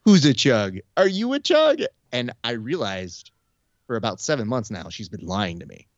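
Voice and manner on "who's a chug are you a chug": high, loud cooing at a pet; playful pet-talk voice, rising high; dog-owner baby talk. Retold or imitated. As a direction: gush at your dog, pitch leaping up. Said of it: "I say something akin to".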